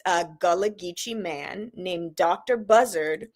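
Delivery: normal talking voice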